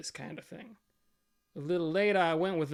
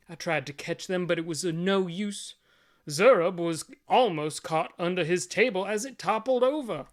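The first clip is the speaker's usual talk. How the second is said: ordinary delivery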